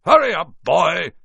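loud, high call from the kitchen; shouting. A narrator's reading. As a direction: bellow an impatient order at the boy. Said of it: "shouted Uncle Vernon from the kitchen".

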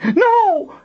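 very high, desperate cry; screamed. A narrator's reading. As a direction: scream to stop him. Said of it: "Colin screamed".

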